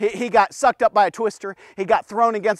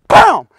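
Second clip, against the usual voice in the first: loud, higher boom; sound effect boom; 7 semitones higher, 9 dB louder than usual. Shown as a demonstration. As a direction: snap it like a towel crack. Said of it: "What do you do with the towel".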